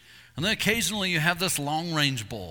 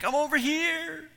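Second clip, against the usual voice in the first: high, slow, far-off calling voice; distant call; 13 semitones higher, slower than usual. Retold or imitated. Believers answering God from afar, high distant call. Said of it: "we're like".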